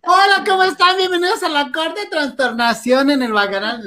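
high, loud chirpy host greeting; hammed-up show host welcome; mock TV host. Host opening the show, mock cheery greeting. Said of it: "Ya me imagino yo".

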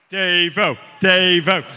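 loud call across the room. Real, not acted. Host calling out to a crew member, loud. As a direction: call him over.

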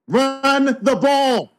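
high, drawn-out emphatic call; Richard Sherman. Acted. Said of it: "in my best Richard Sherman voice".

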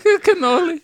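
high, loud squeaky voice crack. Retold or imitated. Host imitating Marcel's cracked voice, high loud squeak. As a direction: let the voice break upward. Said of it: "He's like ... his voice cracked".

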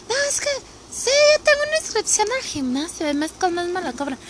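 high mock voice of a lazy friend; imitating acquaintances. Acquaintances admitting they pay the gym, voiced high in mockery. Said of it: "muchos de mis conocidos me dicen".

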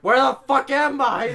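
high, loud retold yell; hammed-up panicked shout. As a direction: cry out, lost and frantic. Retold panic searching wrong rooms.

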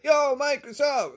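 high, loud imitation of an eager greeting; over-excited. Night-shift clerk greeting a regular, over-excited. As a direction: shout hello like an over-eager bro. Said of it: "he gets more excited".

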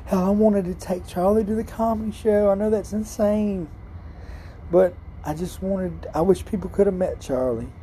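strained high voice, close to tears; holding back tears over a lost pet; voice breaking. Real, not acted. Telling listeners he misses his cat, voice breaking.

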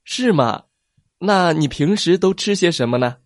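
curious plesiosaur. Acted. Plesiosaur asking T-rex what he eats, curious. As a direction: ask sweetly and curiously.

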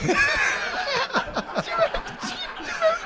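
very high sustained laughing squeal; shrieking laugh. Real, not acted. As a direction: let the laugh climb into a shriek. Laughing at the story.

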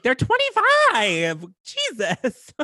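slow, high, incredulous retort; exasperated disbelief. Retold or imitated. Viewer answering those calling twenty-five old, exasperated. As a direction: stretch it out in exasperation.

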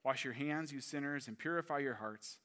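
soft, measured Bible reading; scripture reading. Acted. Reading James four to the congregation, soft and measured. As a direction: read it to the flock.

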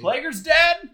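shocked loud high question. Real, not acted. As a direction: blurt it out in disbelief. Shocked at news of death.

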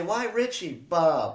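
high, hammed-up wail; mother grieving and blaming Bob, hammed up; mock accusing mother.

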